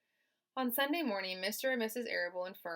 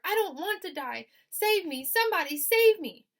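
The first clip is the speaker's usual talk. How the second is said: high, loud pleading shout; panicked cry; 11 semitones higher, 13 dB louder than usual. Acted. Wilbur begging to be saved, high loud panic. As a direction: beg for rescue at full pitch.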